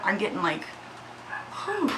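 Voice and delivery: ordinary talk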